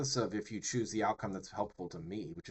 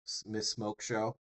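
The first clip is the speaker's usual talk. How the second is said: usual speaking voice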